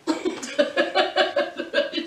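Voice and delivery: breathy, amused laugh; real laughter. Real, not acted. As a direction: crack up at your own joke. Laughing at her own invented quote.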